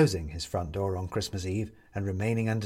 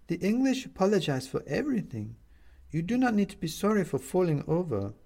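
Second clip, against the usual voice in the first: a worried neighbour finding him; neighbour woman voice; 8 semitones higher than usual. Acted.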